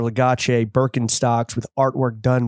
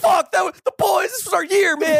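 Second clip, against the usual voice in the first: anguished shout. Real, not acted. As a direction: cry out like your team just lost. Mourning the team's elimination.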